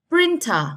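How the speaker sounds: Printer, PRIN pitched high, then dropping; first-syllable stress. Shown as a demonstration. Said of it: "Vẫn là vần một".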